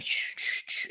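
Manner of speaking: whispered shh, shh, shh; Keanu shushing. Retold or imitated. Imitating Keanu hushing people in a whisper. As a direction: hush them in an unvoiced whisper. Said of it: "he's like".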